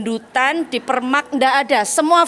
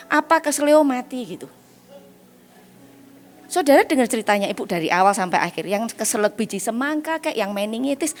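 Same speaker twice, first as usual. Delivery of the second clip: ordinary talk, nothing marked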